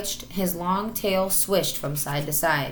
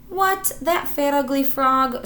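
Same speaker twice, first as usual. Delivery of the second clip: slow, high, louder scoff; 8 semitones higher, 4 dB louder than usual. Acted. Marmaduke mocking the idea the hippo is his uncle, scoffing. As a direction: scorn the big ugly thing. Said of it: "scoffed Marmaduke".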